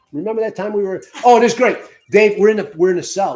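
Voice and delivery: high, loud burst of delight; excited recollection. Real, not acted. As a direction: burst in as a great story comes back. Excited at recalling a story.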